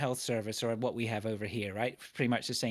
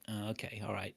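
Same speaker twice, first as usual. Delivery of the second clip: softer, deflated mock acceptance; resigned; 3 semitones lower, 7 dB quieter than usual. Agreeing to reschedule with the dentist, resigned. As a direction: give in with a sigh of a voice. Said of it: "I'm like".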